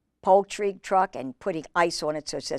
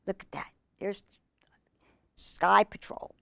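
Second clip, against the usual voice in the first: whisper; 9 dB quieter than usual. Real, not acted. Whispered excited aside.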